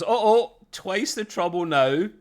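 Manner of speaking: loud, high comic uh-oh; mock alarm. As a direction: call out playful dread. Acts out reaction to double trouble.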